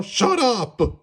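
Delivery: high, loud angry scream. A narrator's reading. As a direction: scream to cut off the voice. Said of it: "screamed Toad".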